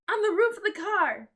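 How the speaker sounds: high, loud eager shout. Acted. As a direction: yell it across the crowd. Shouted answer.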